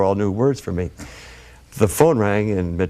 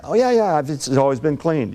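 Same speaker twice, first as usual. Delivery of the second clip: high, flustered "oh yeah yeah"; overeager bluff; 6 semitones higher than usual. Retold or imitated. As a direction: pretend you know what you're doing. Faking experience, defensive.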